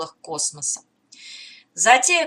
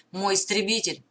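plain reading voice

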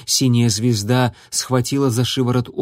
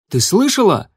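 raised urgent call; shouting; 6 semitones higher, 4 dB louder than usual. A narrator's reading. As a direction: call out sharply. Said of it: "крикнул он".

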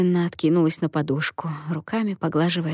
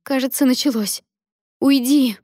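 high, urgent cry, louder on the last word; 7 semitones higher, 4 dB louder than usual. A narrator's reading. Labouring Lia sends Alen away, crying out high. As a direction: exclaim it, then order him away. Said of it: "крикнула Лия".

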